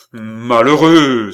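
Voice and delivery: long, loud exclamation; outraged cry. Acted. Philippe cries out at the count's indifference, outraged. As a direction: draw the word out in a shout. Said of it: "s'écria Philippe".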